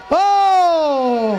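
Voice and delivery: loud sudden oh of surprise; surprised exclamation. Real, not acted. Emcee reacting to the race finish, loud surprised oh. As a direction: blurt it out as the race ends. Winner crosses the line.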